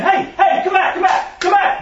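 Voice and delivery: high frantic shouts of come back; yelling after dog. Retold or imitated. Trainer yelling at fleeing dog.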